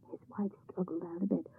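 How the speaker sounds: plain reading voice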